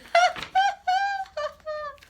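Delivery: very high loud giggling fit; gleeful child cackle; boy's helpless laughter. Acted. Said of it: "tears rolling down cheek".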